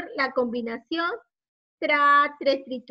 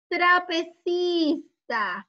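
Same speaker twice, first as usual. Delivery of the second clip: very slow, higher TRAPECISTAS; drawn-out model word; 4 semitones higher, much slower than usual. Shown as a demonstration. Teacher introducing an image with tra, very slowly drawn out. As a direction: stretch "Trapecistas" syllable by syllable, pitched up.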